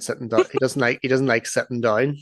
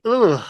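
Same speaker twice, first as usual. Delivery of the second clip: high, falling groan of disgust; recoiling "ugh"; 10 semitones higher, 4 dB louder than usual. Retold or imitated. Reacting as pregnancy news sinks in, mock-disgusted groan. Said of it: "you can see me recoil from her".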